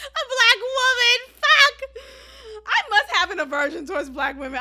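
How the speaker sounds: loud laughing shriek through the words; real laughter squeezing the words; laughing hard. Real, not acted. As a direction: crack up while forcing the words out. Laughing fit at own joke.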